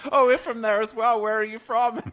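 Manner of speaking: high, loud imitation of delighted Americans; excited, high-pitched mock American customer; eager American customer. American at the drive-thru greeting a supposed neighbour, mock excited. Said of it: "they would say".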